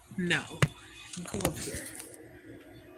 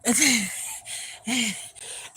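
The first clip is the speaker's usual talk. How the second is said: loud laugh; 4 semitones higher, 7 dB louder than usual. Real, not acted. Laughing between lines.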